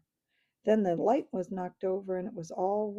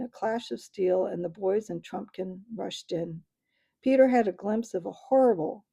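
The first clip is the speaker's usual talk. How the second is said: ordinary delivery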